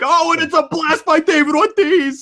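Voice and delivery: high-pitched mock home-run call; excited baseball announcer. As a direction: call it like a thrilled play-by-play man. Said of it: "He had to call the game like".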